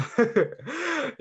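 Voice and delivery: short high-pitched chuckle; a quick laugh that rises high; self-conscious laugh. Real, not acted. Speaker excusing their slightly stiff Indonesian, high embarrassed chuckle.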